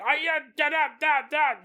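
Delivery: loud, high made-up alien chant; mock Na'vi war cry, high and loud; fake Avatar language. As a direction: bellow nonsense alien words to scare them. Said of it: "did the, the Avatar language".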